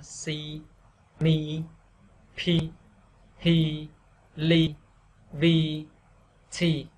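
each syllable alone on a low, level tone; "si, mi, pi" as separate low-tone drills; low falling tone. Shown as a demonstration. Teacher drilling consonants with "i", low falling tone. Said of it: "đọc theo huyền".

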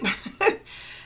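breathy, high-pitched giggle; laugh. Real, not acted. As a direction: laugh at your own silver lining. Laughs at joke about sleeping.

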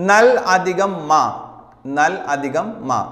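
words stretched and spaced; slow dictation. Shown as a demonstration. Teacher to students, slowly repeating the split. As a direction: say it slowly so they can write it down. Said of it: "teacher dictates the split form".

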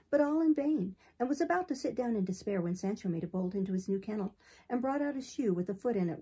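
usual speaking voice

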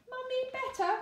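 put-on high little toddler question; baby voice. Baby asking her sick mother, high toddler voice. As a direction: ask like a tiny child checking on mummy.

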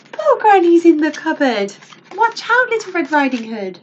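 high, loud storytime warning; mock alarm. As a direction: cry out in playful alarm for Granny and the girl. Storyteller alarmed, warns the heroine.

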